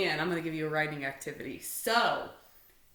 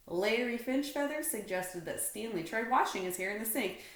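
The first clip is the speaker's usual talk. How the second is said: usual speaking voice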